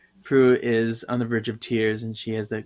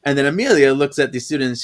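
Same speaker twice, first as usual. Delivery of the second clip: usual speaking voice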